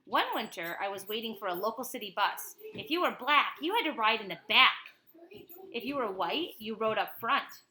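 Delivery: lively read-aloud of a picture book; reading to a child. Acted. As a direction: tell it like a bedtime story to a child. Parent reads picture book aloud.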